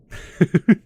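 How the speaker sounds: short, high burst of laughter; loud laugh. Acted. Laughs at the assistant's reply.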